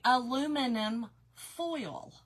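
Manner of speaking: "aluminum foil" slowly, every syllable sounded; over-enunciate "aluminum foil", giving "foil" its full vowel; careful enunciation. Shown as a demonstration. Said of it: "I mean, I can enunciate".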